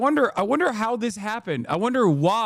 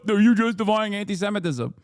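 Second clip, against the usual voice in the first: mock Hasbara tone. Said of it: "tries to hit that fucking Hasbara tone".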